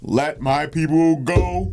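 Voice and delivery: slow, high, loud booming proclamation. Pretend. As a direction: boom it out like a prophet. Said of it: "But I don't have the booming voice".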